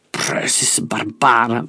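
slow, low curse; cursing. A narrator's reading. Older man cursing the Prussian soldiers, slow and low. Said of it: "vloekte een oudere man".

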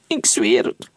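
a touch of tearful pleading; mildly weepy, begging; crying. A narrator's reading. Frightened scout swears to his captors, mildly tearful. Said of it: "huilde de verkenner".

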